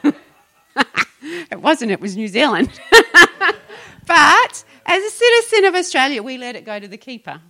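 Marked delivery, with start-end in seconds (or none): 2.8-3.9 loud high burst of laughter; big real laugh. Real, not acted. Laughing at own slip.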